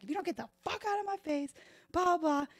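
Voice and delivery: high, fast imitated rant; a hammed-up bouncer losing it; mock furious threat. Door guy ordering her out, a high, fast mock rant. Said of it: "He's like".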